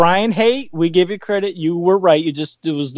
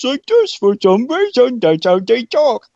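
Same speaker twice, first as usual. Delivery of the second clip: mimicking Tyler; 5 semitones higher, slower than usual. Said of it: "that's how everybody talks in Tyler's mind".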